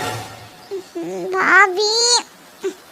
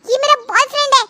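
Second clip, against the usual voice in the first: put-on higher, upset young sister-in-law; whiny higher-pitched protest; complaining young girl; 6 semitones higher than usual. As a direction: scold her for hitting him. Lily complains she hit boyfriend.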